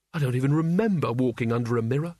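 plain reading voice

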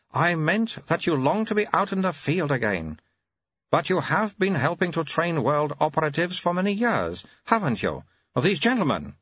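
put-on very high, babyish old abbot voice; an ancient mind in a baby's mouth; high piping infant abbot; baby-bodied old abbot. Abbot continues.

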